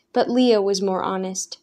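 ordinary delivery, nothing marked

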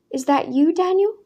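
rising high on the name, frail and unsure; old woman peering, not sure it is him; quavering old grandmother. A narrator's reading. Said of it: "that quavering voice".

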